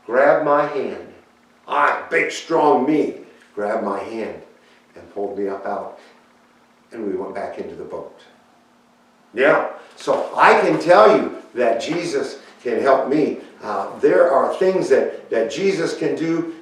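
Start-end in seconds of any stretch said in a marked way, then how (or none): none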